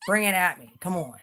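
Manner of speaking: defiant shout at the trolls; loud challenge. Real, not acted. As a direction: dare them to come at her.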